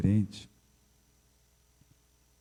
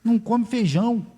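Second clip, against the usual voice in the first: worried parent to the doctor, high; worried mother; 9 semitones higher than usual. Retold or imitated. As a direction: complain to the doctor about the boy.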